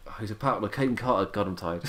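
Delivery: usual speaking voice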